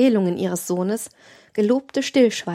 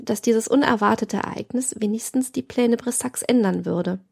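usual speaking voice

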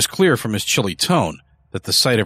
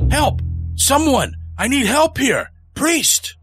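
desperate shout; slower than usual. A narrator's reading. Early shouting for help in the empty church, desperate high yell. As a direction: bellow for the priest at full force. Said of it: "shouting at the top of his lungs".

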